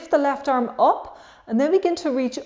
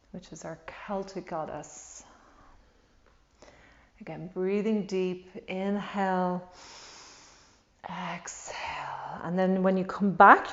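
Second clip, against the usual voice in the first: guided meditation; 3 semitones lower, 7 dB quieter than usual. Acted. Leads a guided breathing meditation.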